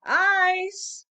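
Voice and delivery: loud, high call down the street; street vendor's call. Acted. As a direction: cry out your wares. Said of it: "the Iceman calls".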